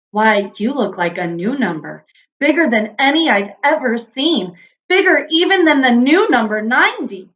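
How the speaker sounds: ordinary delivery, nothing marked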